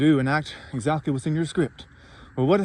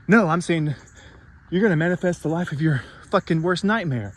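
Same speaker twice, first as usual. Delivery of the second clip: ordinary talk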